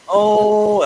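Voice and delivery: sudden loud high oh; a startled realization; surprised oh. Real, not acted. Surprised by the news about the book, loud oh.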